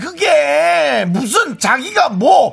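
high, loud, drawn-out comic outburst; exasperated wailing. Acted. Wife agreeing with her friend about her husband, wailing in exasperation. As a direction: wail the complaint, stretching the words.

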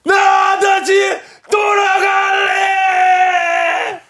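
high, slow, anguished yell; movie line shout. Pretend. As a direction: scream it from the railway tracks. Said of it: "형님 한번 해주세요".

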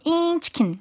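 high, soft robot chirp; toy robot voice. Retold or imitated. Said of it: "로봇 흉내를 내더니만".

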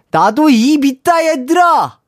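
loud, high-pitched mock wail, drawn out; pent-up outburst. Mother whose three sons ate all the gimbap, hammed-up indignant yell. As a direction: play an aggrieved mom yelling at her kids. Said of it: "울분을 토로하시는데".

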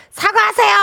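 a lightly voiced comedy catchphrase, high-pitched; her comedy catchphrase. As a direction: fling out the gag line as on the show. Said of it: "내가 코빅에서".

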